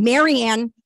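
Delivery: loud drawn-out call of a name; retold scream. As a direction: holler down to her, reliving it. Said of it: "And I scream down".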